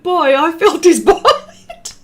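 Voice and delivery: can't hold the laugh on the last word; laughing while reading. Real, not acted. Reading funny comment, cracking up.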